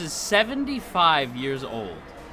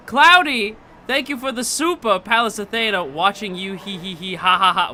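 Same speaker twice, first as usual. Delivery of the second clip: 1 playful thanks; 6 semitones higher, 7 dB louder than usual. Real, not acted.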